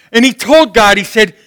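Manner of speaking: ordinary talk, nothing marked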